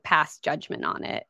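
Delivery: usual speaking voice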